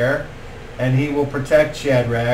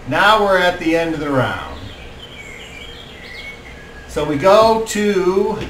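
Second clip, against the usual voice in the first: ordinary delivery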